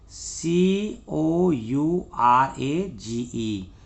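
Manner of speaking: slow letter-by-letter dictation; spelling out. Shown as a demonstration. Teacher spelling out the word.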